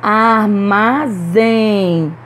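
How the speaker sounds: "Armazém" very slow, in three syllables, strong zém; stress on last syllable. Shown as a demonstration. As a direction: stretch out "Armazém", leaning on zém. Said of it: "Pronunciamos com mais força a última sílaba". ("Armazém" bem devagar, silabado, com força em zém)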